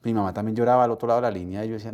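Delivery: ordinary delivery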